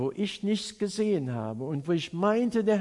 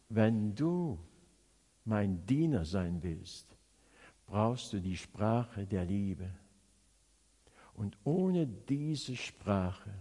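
low, soft and slow, with long pauses; solemn gentle counsel; 8 semitones lower, 6 dB quieter, slower than usual. Retold or imitated. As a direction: voice the Lord's quiet instruction, unhurried. God's solemn word to the preacher.

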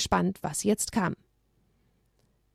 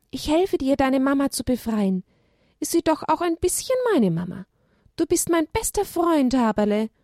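higher eager little donkey voice; donkey calling while running; 6 semitones higher than usual. Acted. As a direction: promise help to your best friend. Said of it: "rief er ihm während des Laufens zu". (Eselchen ruft im Laufen, höhere Stimme)

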